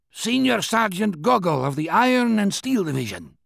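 lightly voiced old veteran soldier; a hint of a proud old sergeant; soviet soldier. A narrator's reading. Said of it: "the old man said".

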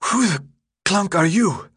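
high, breath-broken demand; winded challenge. A narrator's reading. Exhausted runner challenges newcomer Thomas, winded and irritated. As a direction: demand it while catching your breath.

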